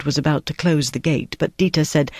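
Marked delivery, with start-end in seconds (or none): none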